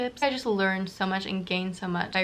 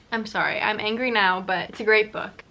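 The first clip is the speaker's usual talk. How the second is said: normal talking voice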